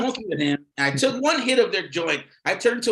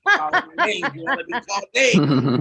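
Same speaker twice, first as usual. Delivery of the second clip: playful tease; 7 semitones higher, 5 dB louder than usual. Retold or imitated. Mock bedroom exchange.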